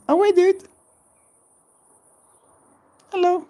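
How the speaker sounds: sing-song cooing at a small animal; cutesy high voice. Real, not acted. Greeting lizard again.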